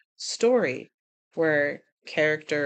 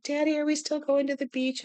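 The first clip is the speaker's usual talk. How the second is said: put-on higher, small hopeful girl's voice; 7 semitones higher than usual. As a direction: ask it like a little sick girl. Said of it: "And she's like".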